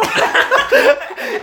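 high squealing burst of laughter. Real, not acted. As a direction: let out a high giggle. Laughing at own story.

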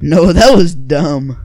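slow, loud shout of scorn; loud dismissal. Real, not acted. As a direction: shout it down, drawing the words out. Loudly rejecting friend's joke.

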